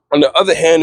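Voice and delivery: ordinary delivery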